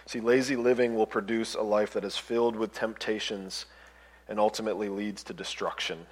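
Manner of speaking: usual speaking voice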